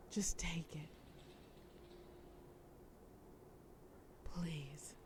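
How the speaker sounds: hushed, near-begging whisper; pleading whisper. Acted. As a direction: whisper it like a last offer. Villain pleading with the detective.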